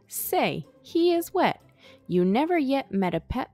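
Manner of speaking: ordinary delivery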